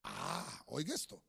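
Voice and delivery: ordinary talk, nothing marked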